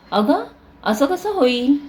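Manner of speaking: disbelieving, voice lifting; mother amazed the box is empty; surprised. A narrator's reading. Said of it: "आईला नवल वाटले".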